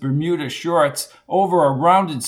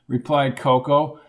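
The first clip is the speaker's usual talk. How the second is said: ordinary delivery, nothing marked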